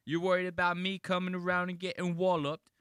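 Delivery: loud, high, hammed-up British voice; mock British accent. Mocking a British remake of a TV monologue, loud British accent. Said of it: "he's trying to do it in, like, a British accent".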